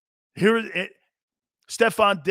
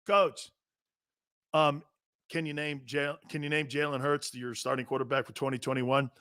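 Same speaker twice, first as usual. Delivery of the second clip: quiet, low, hesitant press question; softer, lower retold question; imitated reporter; 5 semitones lower, 7 dB quieter than usual. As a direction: lightly mimic a reporter carefully putting a question to the coach. Acts out reporter questioning coach.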